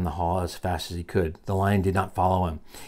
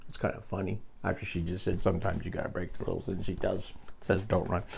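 quiet aside; 9 dB quieter than usual. Real, not acted. Amused aside to the child.

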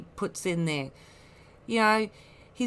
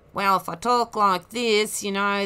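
louder, different put-on voice; made-up voice; 6 dB louder than usual. Shown as a demonstration. Showing how easy it is to fake a voice, louder put-on voice. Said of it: "we can all make up voices".

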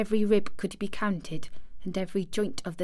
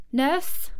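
high, rising summons; imperious child's call; commanding; 6 semitones higher than usual. A narrator's reading. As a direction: call the servant to order. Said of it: "she commanded".

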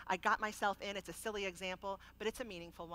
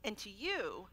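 usual speaking voice

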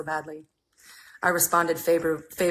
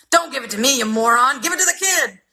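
higher, louder barked order; snapping manager; 8 semitones higher, 5 dB louder than usual. Acted. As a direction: snap at the clerk impatiently. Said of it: "The manager snapped".

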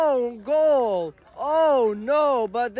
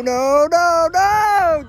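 frustrated shout; 9 semitones higher, 5 dB louder, much slower than usual. Real, not acted. Watching the opponent score.

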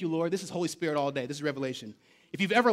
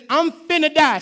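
mock Jesus line; 8 semitones higher, 10 dB louder than usual. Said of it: "Jesus says".

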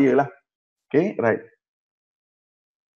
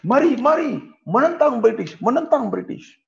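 rallying call; 9 semitones higher than usual. Retold or imitated. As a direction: urge the crowd to rise up. British voiced rallying the people.

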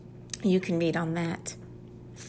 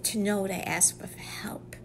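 whisper; 9 dB quieter than usual. Real, not acted. Preacher drops to whisper.

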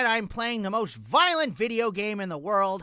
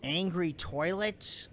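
usual speaking voice

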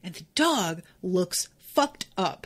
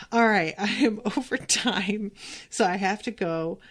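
ordinary talk, nothing marked

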